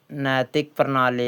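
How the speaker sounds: ordinary talk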